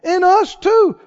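high, slow scoffing challenge; the thief sneering at Jesus; drawn-out high jeer; mocking tone. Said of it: "Same tone".

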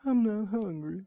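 soft pouting mumble; quiet sulky reply; sulking husband. Acted. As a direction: pout like a sulking child. Ahab sulking answer.

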